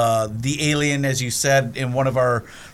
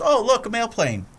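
higher, wide-eyed innocent exclamation; naive Ned voice; 9 semitones higher than usual. Retold or imitated. Ned spotting a plane, innocent higher exclamation. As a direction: point at the sky like a clueless kid. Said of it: "he looks up and he goes".

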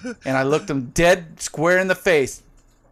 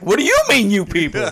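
much higher, offended squeak; squeaky gut voice; 5 semitones higher, 3 dB louder than usual. Retold or imitated. Gut echoing the offended question, high and squeaky. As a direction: pitch way up like an indignant little gut. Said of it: "your gut's like".

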